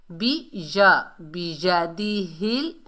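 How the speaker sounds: बियदिहिल slowly with a distinct या; clear y sound. Shown as a demonstration. Teacher corrects learners, slow बियदिहिल with clear या. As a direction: break off बी या, then stretch बियदिहिल. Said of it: "या की sound वाजी होनी चाहिए".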